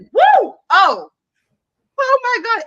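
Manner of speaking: high, loud whoop and gasp of delight; excited whoop. Real, not acted. Gushing to the co-host about the narrators. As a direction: swoon over the voices.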